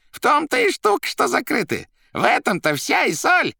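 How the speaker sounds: very high, loud character voice; excitable Koroviev. A narrator's reading. Koroviev confirming his suspicions, high and excitable. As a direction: exclaim theatrically in a high voice.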